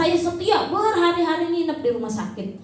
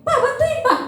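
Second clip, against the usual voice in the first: wife calling husband; 10 semitones higher than usual. Retold or imitated. Wife calls husband for help.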